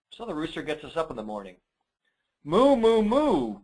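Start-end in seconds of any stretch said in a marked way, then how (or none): 2.4-3.6 high, loud playful mooing; cow moo. Acted. Parent reading a farm book to kids, playful high moo. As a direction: moo like a cartoon cow for a child.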